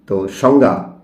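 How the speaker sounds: ordinary delivery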